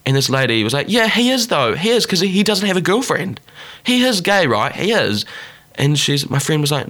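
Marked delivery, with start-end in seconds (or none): 0.9-5.2 high-pitched imitation of a gossip; mock insistence, arguing the point; insistent. Church lady arguing with the best friend, insistent.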